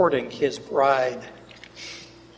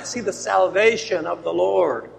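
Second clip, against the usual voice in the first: normal talking voice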